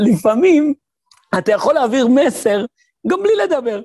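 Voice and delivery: excited. Real, not acted. Punchline after laughter.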